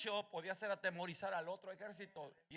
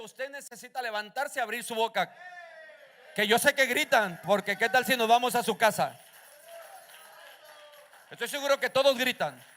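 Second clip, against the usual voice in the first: loud, rousing sermon delivery; fiery preaching; 5 semitones higher, 16 dB louder than usual. Acted. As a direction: preach full force to the church. Preaching at full force. (predicación a todo pulmón)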